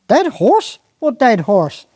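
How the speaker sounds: high shocked squire. Acted. Squire hears his horse is dead, shocked. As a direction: squire yelps at the news.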